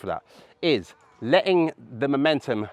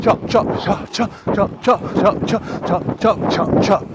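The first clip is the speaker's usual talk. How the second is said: high, loud chop calls while skating; calling out the chop; 8 semitones higher, 6 dB louder, slower than usual. Real, not acted. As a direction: chant the move as you do it. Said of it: "saw me go from the chop".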